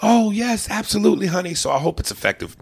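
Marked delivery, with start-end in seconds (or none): 0.0-1.5 high, gushing lady voice; lightly put-on swooning woman; mock women. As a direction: coo it like an eager fan. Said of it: "all the women have been going".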